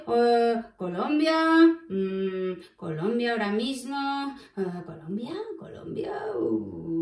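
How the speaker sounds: rack your brain, trailing into a near-silent murmur; stumped, thinking. Acted. Cuquín trying to recall anything about Colombia, stumped.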